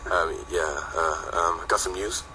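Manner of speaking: deadpan, low and soft, utterly unexcited; unconvincing. Retold or imitated. Manager ringing the band with big news, flat and underwhelming. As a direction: mumble huge news like it's nothing. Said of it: "in the most unconvincing way possible".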